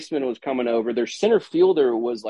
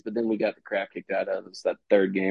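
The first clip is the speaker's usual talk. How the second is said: ordinary talk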